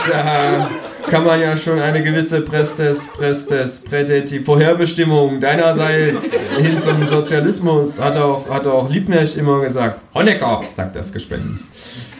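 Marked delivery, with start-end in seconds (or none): 0.1-10.6 ghost rambling in a raised voice; higher character voice, tripping over long words; lightly voiced ghost. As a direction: ghost with a somewhat raised pitch. Ghost figure talking in story.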